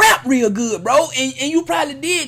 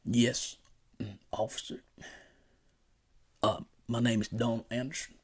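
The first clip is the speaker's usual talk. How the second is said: low, very soft, halting answers to an officer; polite suspect act; 11 semitones lower, 14 dB quieter than usual. Shown as a demonstration. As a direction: play the meek, cooperative interviewee. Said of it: "go in there just like this".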